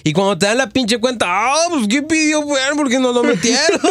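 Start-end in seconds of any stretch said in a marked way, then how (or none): 1.3-3.8 joking customer. Retold or imitated.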